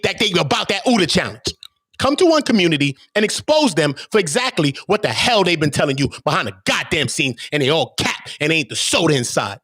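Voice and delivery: ordinary talk, nothing marked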